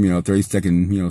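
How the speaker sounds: ordinary talk, nothing marked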